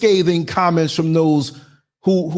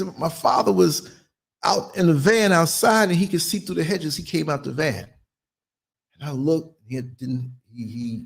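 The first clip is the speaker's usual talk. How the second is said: ordinary delivery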